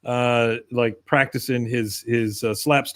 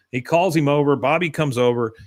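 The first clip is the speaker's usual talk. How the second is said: ordinary talk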